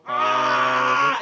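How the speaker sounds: long, high, loud scream; scream of pain. Acted. Knights split apart into threads, scream of pain. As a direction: hold an agonized acted scream. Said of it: "as they scream out in pain".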